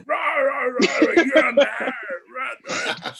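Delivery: 高く吠えるような、ふざけた怒鳴り声で; a mock courtroom growler roaring rah at a witness. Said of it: "somebody that shouts up and goes".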